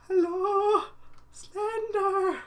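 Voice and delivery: high sing-out, long held vowels; mock-timid falsetto greeting; falsetto call. Player calling out to Slender in the dark, falsetto.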